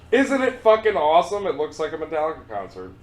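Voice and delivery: excited. Pretend. Captain parody breaks into excitement.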